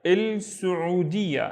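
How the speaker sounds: "El Saudiya" slowly, syllables run together; slow, connected model of "El Saudiya"; joined-up word. Shown as a demonstration. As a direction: say "El Saudiya" as one careful word. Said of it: "Let's do it again".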